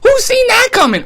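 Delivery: high pitched who's, falling away; mock disbelief. Real, not acted. Vlogger marveling at the coach firing, high rhetorical question. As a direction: sell the surprise on Who's.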